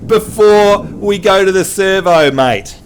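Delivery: broad Australian, slow; Aussie accent. Shown as a demonstration. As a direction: say it like a true-blue Aussie. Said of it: "honorary Aussie".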